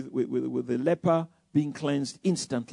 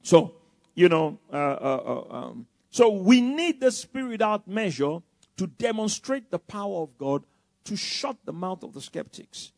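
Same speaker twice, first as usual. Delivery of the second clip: ordinary delivery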